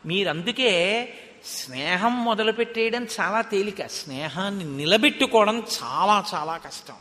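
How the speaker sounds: ordinary delivery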